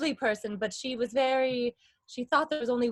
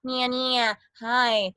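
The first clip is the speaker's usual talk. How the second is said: bright-placed, loud open calls; more open voice; 5 dB louder, much slower than usual. Shown as a demonstration. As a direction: open up and let it ring in the face. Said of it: "I'm suddenly more open, like".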